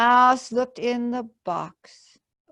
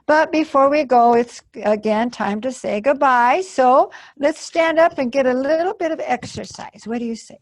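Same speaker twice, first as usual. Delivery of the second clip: cheery rally; 4 semitones higher, 6 dB louder, faster than usual. Real, not acted. Librarian rouses the children to stand and exercise, louder and fast.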